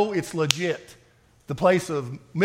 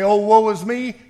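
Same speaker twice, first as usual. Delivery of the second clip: loud, higher mock whine; mock complaining; 5 semitones higher, 8 dB louder than usual. Preacher mocking a complainer to the church, loud mock whine. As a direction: whine it like a self-pitying grumbler. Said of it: "complain to that point and say".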